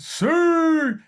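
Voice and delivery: high, loud Ronaldo-style "Sue!" yell; Siu celebration shout. Retold or imitated. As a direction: belt out the goal celebration cry high and loud. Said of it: "I actually ran and went".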